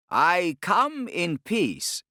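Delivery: honeyed menace, drawn out; smooth and pleasant. Acted. Torquemada assuring the Sultan, smooth and pleasant. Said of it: "his voice smooth and pleasant".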